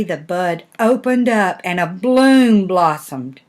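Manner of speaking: usual speaking voice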